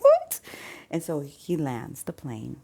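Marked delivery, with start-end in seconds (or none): 0.0-0.4 a sudden, much higher and louder startled "What?"; shocked cry. Retold or imitated. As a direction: yelp it out in alarm. Wife hears they are landing.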